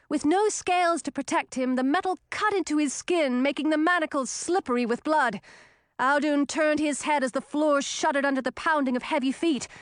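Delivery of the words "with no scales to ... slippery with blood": ordinary delivery, nothing marked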